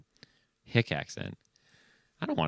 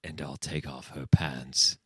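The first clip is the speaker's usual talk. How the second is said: deadpan priest; 6 semitones lower, slower than usual. Acted.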